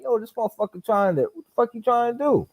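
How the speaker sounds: high, exasperated disbelief; what is he doing to me; incredulous. Retold or imitated. Boxer quoting his outrage at a hard-hitting sparring partner, high disbelief.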